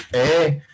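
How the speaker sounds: "e" launched by an ejective k pop, drawn out; glottalized k'e. Shown as a demonstration. Teacher shows the glottalized sound, "e" after a popped k. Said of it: "nuestra variante es glotalizada".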